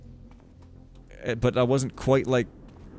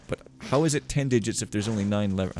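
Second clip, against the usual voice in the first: normal talking voice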